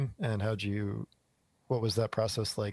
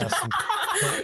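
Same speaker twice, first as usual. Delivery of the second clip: breaking into laughter mid-word; high, slow, laughing through it; 6 dB louder, slower than usual. Real, not acted. Laughs mid-question at the joke.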